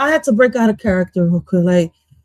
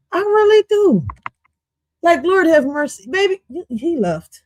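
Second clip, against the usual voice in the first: ordinary talk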